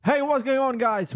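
bright, high host greeting; energetic intro. Real, not acted. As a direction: hype up the show opening.

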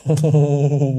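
eerie closed-mouth giggle; repeated little chuckles, like a ghost; imitated ghost laugh. Passenger showing host how the grinning head laughed, eerie giggle. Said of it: "Gitu ketawanya".

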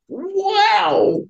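high, very slow drawn-out wow; awestruck wow. Retold or imitated. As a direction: let the wow hang in amazement. Awe at being shown his life.

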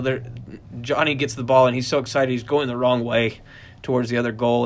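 ordinary delivery

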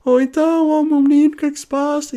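very high, loud fussing grandmother; a worried granny cooing over her boy; high caring granny voice; grandma's voice. Retold or imitated. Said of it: "ficou tipo".

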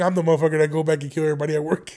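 ordinary talk, nothing marked

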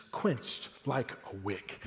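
"quenched like a wick" said soft and very slow, fading to near silence; hushed and slow. Shown as a demonstration. Preacher first reading the verse to the congregation, hushed and slow. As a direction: let "quenched like a wick" snuff out quietly, drawn out.

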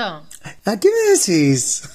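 hammed-up Argentine sing-song; mock Argentine. Guest answering the host in a hammed-up Argentine accent. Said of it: "dejá de hacerte el gallego".

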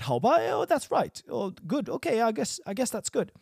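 hammed-up resigned agreement; grudging acceptance. Shown as a demonstration. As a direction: act out a reluctant okay. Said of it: "I'm suggesting it".

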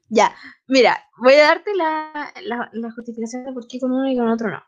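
ordinary delivery

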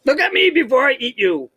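high-pitched mock monster voice; głos potwora. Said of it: "That's what he says to him?".

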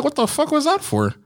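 high, stunned "what the fuck"; shocked outburst. Retold or imitated. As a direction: act suddenly floored by the kick. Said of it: "You're like".

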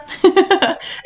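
high, light giggle; amused little laugh. Real, not acted. Laughing at their own British imitation, amused giggle.

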